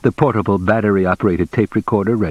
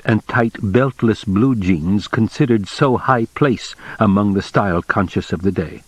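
ordinary delivery

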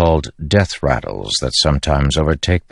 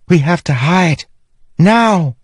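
high, urgent alarm; urgent gasp; 13 semitones higher, slower than usual. A narrator's reading. Syl warns the others to hide from pursuit, urgent gasp. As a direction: gasp out a high-pitched warning. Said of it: "Syl gasped".

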